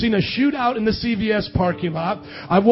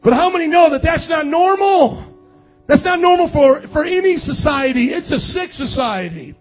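loud, high-pitched preacher's cry; raised pulpit voice, indignant; impassioned preaching; 7 semitones higher, 6 dB louder than usual. Real, not acted. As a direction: drive it home to the congregation at full force. Preaches at full force.

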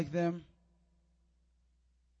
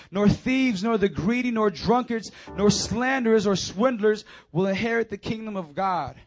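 raised, emphatic preacher's reading; forceful scripture reading; 4 semitones higher, 7 dB louder than usual. Acted. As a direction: read out the list like a stern warning. Reads scripture to congregation.

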